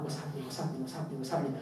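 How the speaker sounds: low, soft anxious muttering to himself; worried murmur. Retold or imitated. The Prophet pacing in and out, softly worried. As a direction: pace and fret under the breath, softly. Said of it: "worried, worried".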